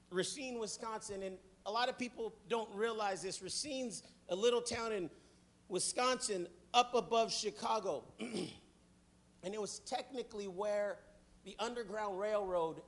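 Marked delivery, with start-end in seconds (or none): none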